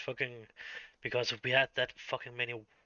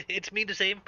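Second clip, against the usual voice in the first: ordinary talk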